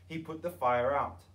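ordinary delivery

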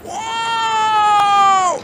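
real high, loud, drawn-out scream; startled yell. Real, not acted. Player caught out mid-game, startled yell. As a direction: yell out in shock, pitch shooting up.

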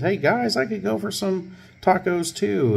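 high-pitched eager sergeant impression; imitating an eager hanger-on; mock eager call. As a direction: pitch it way up, hopefully inviting yourself along. Sergeant calling out to departing detectives.

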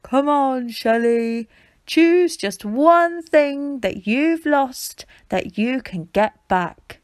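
slow, loud, resonant; booming gently into a tortoise shell; echoing deep voice. A narrator's reading. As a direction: call into the shell so it booms. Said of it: "His deep voice echoed inside her shell".

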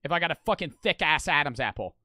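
ordinary talk

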